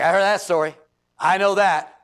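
loud, dismissive know-it-all churchgoer; smug know-it-all. Retold or imitated. Churchgoer mocking the preacher, loud smug scoff. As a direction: scoff like you've heard it all.

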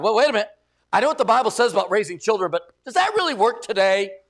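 acted pushy questioning; mock doubting challenge. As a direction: object insistently, acting the doubter. Mimics doubters challenging the Bible.

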